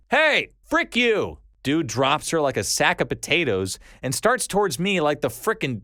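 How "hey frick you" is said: high, drawn-out narrated shout; yelling out. A narrator's reading. Passer-by shouting at a man dragging a woman, yelling out. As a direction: call out a challenge, higher and slower. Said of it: "I yell out".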